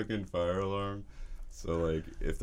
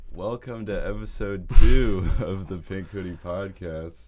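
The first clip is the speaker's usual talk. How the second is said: podcast host intro; 2 semitones higher, 11 dB louder than usual. Real, not acted.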